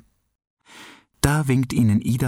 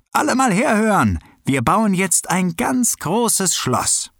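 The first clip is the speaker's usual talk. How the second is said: calling to everyone; 10 semitones higher than usual. Acted. Keno calls the children together to build a castle, high rallying shout. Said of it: "Keno Baukönig schnappt sich die rote Schaufel und ruft".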